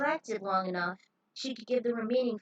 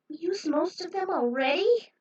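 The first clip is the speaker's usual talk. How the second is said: higher, louder disbelief; dismayed question; 8 semitones higher, 6 dB louder than usual. Acted. Healer finding few beans left, dismayed higher question. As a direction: ask it in dismay.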